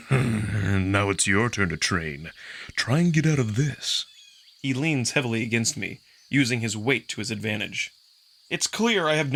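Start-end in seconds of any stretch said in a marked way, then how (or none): 0.1-4.0 wolf pinning Marco, playfully threatening; playful growl. Acted. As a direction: menace him in fun. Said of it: "Amicus growls playfully into my ear".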